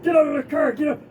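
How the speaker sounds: high, loud barked order; urgent shouted command, imitated; retold yelling guard. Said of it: "young security guard yelling at".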